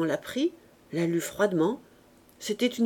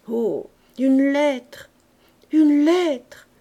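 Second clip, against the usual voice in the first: slow, louder, higher tearful cry over a letter; 6 semitones higher, 7 dB louder, much slower than usual. A narrator's reading. Widow holding out her lover's breakup letter, near tears. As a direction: let the tears break through on each lettre. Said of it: "la voix changée, gagnée par les larmes".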